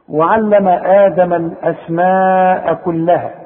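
slow chant, "الأسماء" held long before "كلها"; recitation with madd. Shown as a demonstration. Teacher recites the full phrase, slow with a long "الأسماء". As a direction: recite evenly, stretching the aa of "الأسماء". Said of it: "تمدها أربع حركات".

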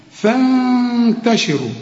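long hummed nasal hold on the noon in فانتشروا; nasal ghunna. Shown as a demonstration. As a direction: draw out فانتشروا slowly through the ghunna. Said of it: "هنا محتاجة غنة". (غنة ممدودة في نون فانتشروا)

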